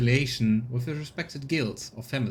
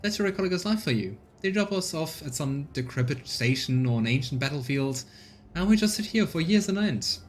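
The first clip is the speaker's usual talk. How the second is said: higher, lively woman's voice; colonist woman; 3 semitones higher than usual. Acted. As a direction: proudly explain the scavenger's life. Voicing Rita explaining job.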